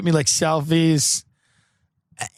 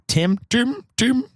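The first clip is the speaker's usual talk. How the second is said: Indian accent attempt; 8 semitones higher, slower than usual. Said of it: "I can't do an accent".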